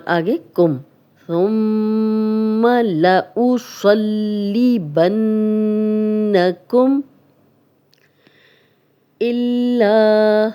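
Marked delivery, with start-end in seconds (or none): 1.3-7.0 slow, drawn-out chanted recitation; slow melodic recitation; Quranic recitation. Shown as a demonstration. Recitation of the verse. 9.2-10.5 الا drawn out with doubled lam and a long alif; slow chanted recitation; tajweed recitation. Shown as a demonstration. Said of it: "لام پہ شد بھی ہے".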